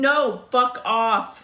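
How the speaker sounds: loud, slow mock-angry rejection; exasperated retold refusal. Woman rejecting date's offer to lie down, exasperated. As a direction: shut him down, drawn out and loud.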